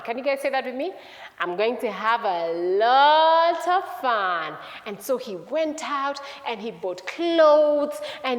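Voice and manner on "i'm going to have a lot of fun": very slow, stretched "lot of fun"; drawn-out storybook relish; gleeful relish. Acted. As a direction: draw it out so kids can join in. Repeats son's line with children.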